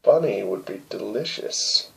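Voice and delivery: low, slow, soft joke; deadpan low aside; quiet aside. Real, not acted. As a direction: mutter it drily under your breath. Quiet joking aside to listeners.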